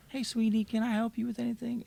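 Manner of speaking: high, sweet little old lady voice, imitated. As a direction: mimic a kindly old lady offering help.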